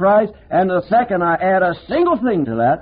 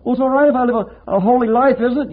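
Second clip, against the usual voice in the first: higher, hopeful churchgoer asking for approval; mock objector, pleading; 8 semitones higher than usual. Preacher mimics a churchgoer seeking approval, higher and hopeful. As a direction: fish for a yes. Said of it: "You say".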